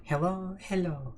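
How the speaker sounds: put-on light Swedish accent, gently sung; mock Swedish accent. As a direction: coo a Swedish greeting. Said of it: "The Swedish one".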